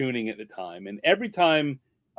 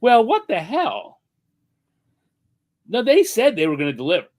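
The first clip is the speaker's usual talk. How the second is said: puzzled; 7 semitones higher, 4 dB louder than usual. Retold or imitated. Puzzled thought, no flowers.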